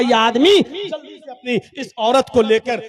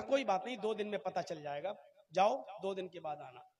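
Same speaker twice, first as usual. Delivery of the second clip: much softer, calmly sending them away; soothing doctor; 3 semitones lower, 18 dB quieter than usual. Acted. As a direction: settle them down gently. Doctor calming the quarrel. (शांत, धीमी आवाज़ में)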